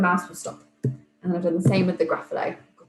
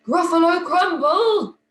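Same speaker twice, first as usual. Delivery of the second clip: high, loud, slow startled monster cry; shocked, scared Gruffalo; 10 semitones higher, 6 dB louder than usual. Acted. The Gruffalo bumps into the mouse, shocked and scared cry. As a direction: yelp it like a frightened monster. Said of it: "he's going to be quite shocked and quite scared".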